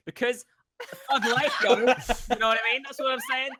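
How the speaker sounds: laughing. Real, not acted. Mock thug persona, laughing.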